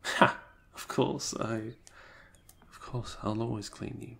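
ordinary delivery

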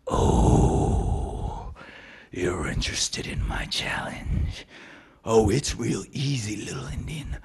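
low, sly whisper; sly whispering elk. Acted. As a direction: tempt him with a hushed voice.

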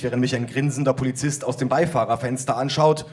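normal talking voice

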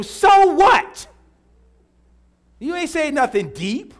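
high, loud preacher's retort; challenging shout. Real, not acted. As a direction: throw it back at them, loud and high. Preacher rebuts an imagined churchgoer.

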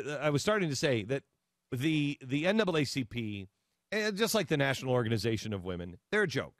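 usual speaking voice

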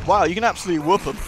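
ordinary delivery

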